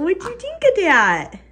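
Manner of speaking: high singsong question falling away at the end. Real, not acted. Mother to baby trying apple, high baby talk. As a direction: coo it high to a little one.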